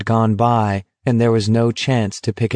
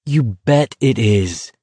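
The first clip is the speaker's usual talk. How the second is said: slow, grim retort; acted grim emphasis; slower than usual. A narrator's reading. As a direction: say it slowly and grimly. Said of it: "Garrity said grimly".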